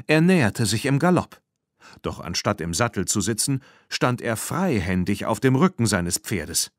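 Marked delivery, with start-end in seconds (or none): none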